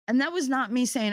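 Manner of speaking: ordinary talk, nothing marked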